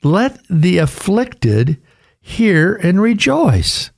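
each word weighed, rejoice falling; slow emphatic reading. Shown as a demonstration. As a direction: Let the afflicted hear and rejoice slowed and pitched high. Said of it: "Listen to what he says here".

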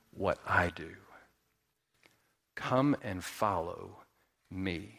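soft, slow rabbi's call, pausing between phrases; hushed, weighty and unhurried; solemn invitation. Acted.

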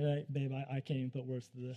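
low, soft, deflated; stunned, subdued reply. Retold or imitated. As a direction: drop to a quiet, lost-for-words murmur. Retold dazed reply to excited wife.

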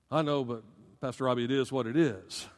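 low, quiet mock resignation; mildly resigned. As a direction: mimic a quick, subdued shrug of a reply. Imitates a resigned reply to him.

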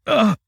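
high, loud grunt of pain; acted groan from a sudden blow. A narrator's reading. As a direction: cry out as if struck. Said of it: "with a smothered groan".

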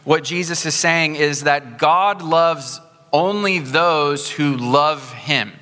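slow, pointed stress on "only" and "those who love him"; misleading inflection. Shown as a demonstration. Pastor shows the congregation a wrong reading, slow and pointed. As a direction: lean hard on "only" to twist the meaning. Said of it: "read it with the right inflection".

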